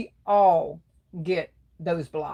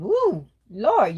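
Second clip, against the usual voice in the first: ordinary talk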